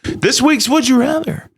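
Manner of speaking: high-pitched, sing-out segment title; segment announcer. Pretend. Host naming this week's game, high announcer voice.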